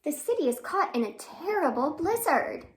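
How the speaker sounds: plain reading voice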